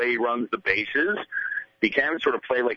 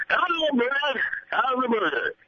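much higher, sing-song rising hello; Leyland's rising greeting; 13 semitones higher than usual. Retold or imitated. Leyland greeting the host in his office, high and rising. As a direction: lift the greeting way up like a cheery skipper. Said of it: "there's this rising intonation".

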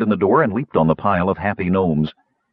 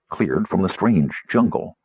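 ordinary delivery

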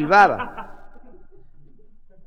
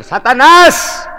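long, rising yell; high, loud, drawn-out call; acted shout; 8 semitones higher, 11 dB louder, much slower than usual. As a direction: summon the devil at the top of the voice. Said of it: "comienza a gritar".

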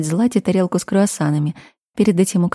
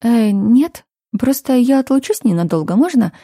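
lightly voiced flustered heroine; 6 semitones higher, 5 dB louder than usual. A narrator's reading. Heroine hides discomfort.

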